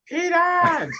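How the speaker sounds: long high call of Peter; yelling from a window. Retold or imitated. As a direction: call him home from upstairs. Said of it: "I can still hear the yelling from the fourth floor kitchen window".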